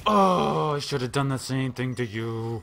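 loud drawn-out "oh", easing off; frustrated outburst. Real, not acted. Player griping at an opponent in frustration. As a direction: cry out in exasperation, then ease down.

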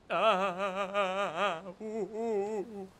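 long wavering wail into hoots; wailing sobs. Real, not acted. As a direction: wail it out in despair. Wailing after a fall.

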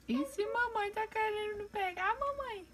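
high, slow cooing pet voice; sweet high baby talk for the cockatiel. Acted. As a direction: coo at the bird like a baby. Talking baby talk to the bird.

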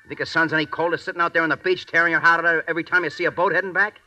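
loud, high, pressing tirade; angry outburst. Acted. Bug catcher berating partner over the jungle heat, angry outburst. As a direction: throw the complaint back at your partner.

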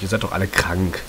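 ordinary talk, nothing marked

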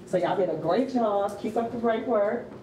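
ordinary talk

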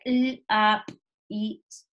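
l, a, p, i, s said one sound at a time. Shown as a demonstration. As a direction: segment lápiz into its five phonemes, pausing between each. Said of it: "tiene cinco sonidos".

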